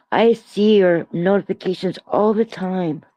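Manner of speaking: ordinary talk, nothing marked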